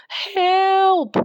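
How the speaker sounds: high, loud yelp for help. A narrator's reading. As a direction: cry out in sudden fright. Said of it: "she s-, yelped".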